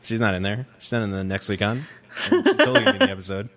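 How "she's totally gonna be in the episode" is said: words bubbling up through a high, fast laugh; can't hold back the giggles. Real, not acted. Joking to co-hosts about Lady Stoneheart, laughing fast and high.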